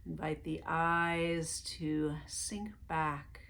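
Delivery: calm meditation guide voice; guided meditation. Acted. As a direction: lead them gently into relaxation, one breath at a time.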